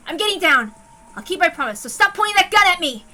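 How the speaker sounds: loud, high, nervous pleading; cling to his promise in fear; frightened bargaining. Acted. Tense bargaining with a gunman.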